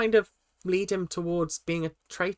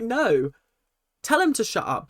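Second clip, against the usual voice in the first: higher, insistent, firmly siding with Ash; excited backing; 7 semitones higher than usual. Retold or imitated. Host recalling urging Ash to silence Sam, higher and insistent. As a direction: urge her on, pitched up. Retelling her own reaction.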